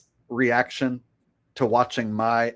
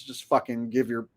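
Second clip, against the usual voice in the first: normal talking voice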